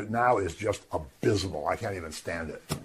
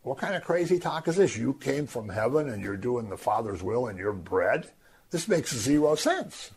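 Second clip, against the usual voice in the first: mock the crazy claim, dismissive; scornful crowd; 3 semitones higher than usual. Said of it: "But they say".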